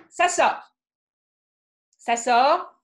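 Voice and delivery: loud, pressed anger; angry outburst. Shown as a demonstration. Singer describing her anger bursting out, loud and pressed. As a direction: it bursts out of you.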